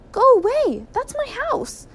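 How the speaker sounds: very high, indignant yell; tiny man's piping protest; calling out. A narrator's reading. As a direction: shout the intruders off your property. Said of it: "called the tiny Mister Gregg".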